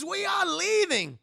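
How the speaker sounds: high, loud, drawn-out command; Captain Bryce. Retold or imitated. Streamer quoting a film officer to chat, slow shouted command. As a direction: bellow a slow evacuation order. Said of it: "Captain Bryce".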